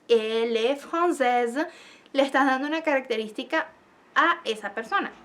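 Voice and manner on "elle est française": slow example sentence. Shown as a demonstration. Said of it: "Cuando tú dices".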